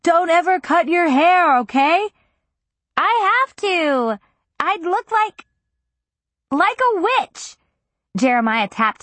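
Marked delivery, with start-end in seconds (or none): 0.0-2.1 raised voice over loud music; yelled. A narrator's reading. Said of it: "He yelled". 3.0-7.6 slow, high, raised over music. A narrator's reading. Heroine shouting over music.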